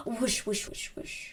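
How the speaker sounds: very soft whooshing; fading, hushed wind noise; imitated breeze. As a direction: whisper it away like passing wind. Imitates a breeze carrying scent.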